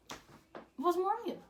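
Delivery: high-pitched, stretched-out repeat of the name; dramatic reveal. Real, not acted. Telling viewers who he cheated with, dramatic high reveal. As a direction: draw it out high, slowly, for effect.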